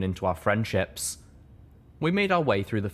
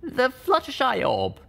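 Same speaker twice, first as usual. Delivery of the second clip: put-on young mare voice; slower than usual. A narrator's reading. Littlepip answers.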